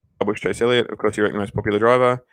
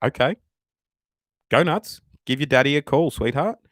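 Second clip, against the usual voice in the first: condescending taunt; 4 semitones higher than usual. Retold or imitated. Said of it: "And I was like".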